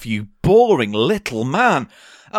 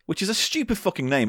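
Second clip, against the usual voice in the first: ordinary talk, nothing marked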